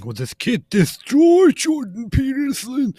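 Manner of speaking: high, loud mock announcer; mocking clickbait voice. Mocking the twins' clickbait caption in a high, loud voice. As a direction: sneer the headline in a silly high voice. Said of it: "they shared a clip saying".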